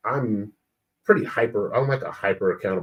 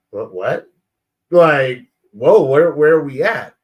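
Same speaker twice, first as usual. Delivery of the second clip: loud, higher mock-startled protest; taken aback; 4 semitones higher, 9 dB louder than usual. Husband reacting to wife's sudden complaint, loudly taken aback. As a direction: act thrown by the accusation, voice jumping up. Acting his startled reply to wife.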